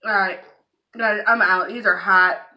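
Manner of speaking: loud, fed-up complaining; loud complaint. Real, not acted. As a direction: declare it loud, done with spice.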